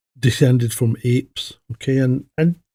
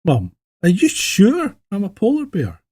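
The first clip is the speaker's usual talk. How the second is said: higher, small voice of a cub; lightly voiced anxious cub; polar bear cub; 7 semitones higher than usual. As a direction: ask like a worried little cub. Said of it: "the polar bear cub goes".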